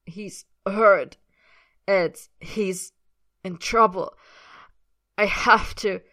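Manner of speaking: pained and loud; desperate. A narrator's reading. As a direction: force the words out through pain. Roy in agony, desperate.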